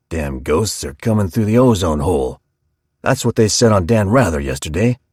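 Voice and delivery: lightly grinding barber's growl; steam shovel voice. A narrator's reading. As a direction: grind it out like stripped gears. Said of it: "voice like a steam shovel with stripped gears".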